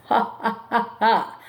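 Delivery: ordinary talk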